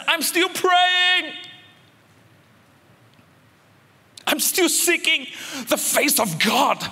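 high, slow, drawn-out cry of faith; defiant fervent cry. Acted. Preacher voicing a persecuted believer, high slow fervent cry. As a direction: lift it high and stretch it out, unshaken.

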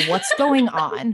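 slow, incredulous "what's going on"; mock confused reaction to a silly book; mock baffled reader. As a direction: drag it out in disbelief. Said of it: "And I'm like".

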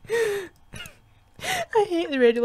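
laughing. Acted. Reading a character line, laughing.

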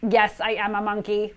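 plain reading voice

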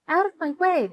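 high, loud storybook shout. A narrator's reading. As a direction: shout to clear a path. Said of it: "shouted Buster".

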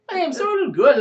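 very high, loud, over-the-top Russian; hammed Russian accent. Driver retries his name, hammed-up high Russian accent. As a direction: push the Russian cabbie to a squeaky extreme. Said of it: "How do you do a Russian accent?".

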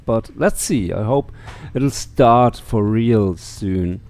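normal talking voice

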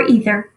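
"either" as EE-ther; "either" with a long E first; long-E pronunciation. Shown as a demonstration. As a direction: open "either" with an ee sound. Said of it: "two ways people, um, pronounce that word".

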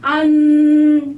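안 drawn out long, high and loud; slow 안 with a nasal end. Shown as a demonstration. Teacher modelling a long nasal 안. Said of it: "이 아가 이쪽에서 나야".

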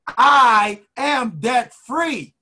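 high, drawn-out declaration; rallying affirmation. Shown as a demonstration. Coach urging listeners to say it again, slow and emphatic. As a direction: lead the chant slowly with conviction. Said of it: "Say it again".

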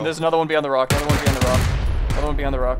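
loud urgent callout, repeated; shouting callout. Real, not acted. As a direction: shout the warning over gunfire. Firefight, calling out enemy.

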